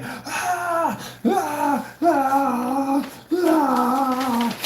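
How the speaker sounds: high-pitched repeated "ah" screams; mock screaming. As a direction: imitate someone screaming at the computer. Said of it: "heard you screaming on your computer".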